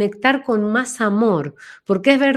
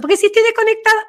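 strained voice; 12 semitones higher, faster than usual. Shown as a demonstration. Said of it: "la voz ahí metida".

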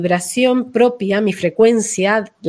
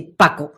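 "Paco" short and low, dropped flat like earth; low, clipped "Paco"; earthy blunt name; 6 semitones lower than usual. Shown as a demonstration. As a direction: drop "Paco" down short and grounded. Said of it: "como pura tierra".

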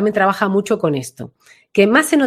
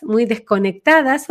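ordinary talk, nothing marked

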